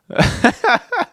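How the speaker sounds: a high burst of real laughter; real high-pitched laugh; high giggle. Real, not acted. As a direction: crack up at your own story.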